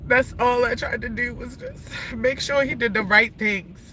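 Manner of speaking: ordinary talk, nothing marked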